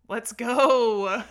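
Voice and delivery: real hype shout; excited cheer. Real, not acted. Host cheering her guest's message, loud and excited.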